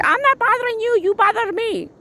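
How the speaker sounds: high-pitched imitation of an angry man; indignant mock protest. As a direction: throw the blame back in a squeaky voice. Retold quarrel, man's retort.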